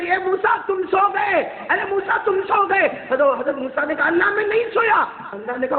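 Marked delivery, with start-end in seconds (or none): 0.0-2.9 high, raised call to a sleeper; ringing, high-pitched accusing call. Acted. Voice from God to dozing Moses, calling out. 4.1-5.0 high-pitched hurried denial; protesting. Acted. Moses denies to God he slept, protesting.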